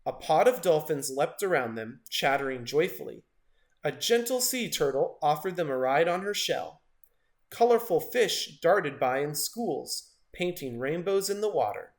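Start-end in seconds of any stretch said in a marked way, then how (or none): none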